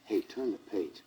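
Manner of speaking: usual speaking voice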